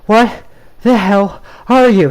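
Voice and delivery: loud panicked yell. A narrator's reading. Boy yells at the wolf in panic. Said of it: "he yelled".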